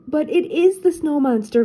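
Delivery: high, urgent insistence; excited exclaim. Acted. Velma insists to Cindy it's the real monster, high and urgent. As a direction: blurt it out, sure she's right. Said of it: "Velma exclaimed".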